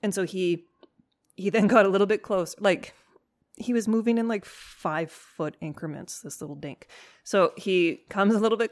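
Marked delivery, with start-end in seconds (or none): none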